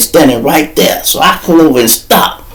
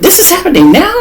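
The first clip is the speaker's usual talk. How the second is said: even higher, rising squeak of disbelief; lady's shocked question; faster than usual. Retold or imitated. Lady asked again by the lawyer, answering high and incredulous. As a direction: repeat her words pitched up high. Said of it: "This is what the lady said".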